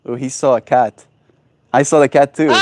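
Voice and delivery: ordinary talk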